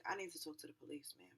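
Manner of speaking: soft, low, composed plea; not wilding. Retold or imitated. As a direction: keep it hushed and civil. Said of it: "I'm not even wilding".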